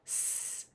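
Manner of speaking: "S" as a sustained sss; S sound. Shown as a demonstration. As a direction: hiss "S" instead of naming it. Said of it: "What's that sound?".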